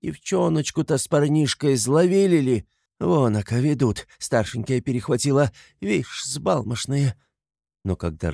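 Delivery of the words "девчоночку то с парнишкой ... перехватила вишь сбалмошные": raised, folksy commenting voice; gossiping onlooker. A narrator's reading. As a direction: remark on the runaways from the crowd. Townswoman gossips about runaway children. (простонародный голос зеваки)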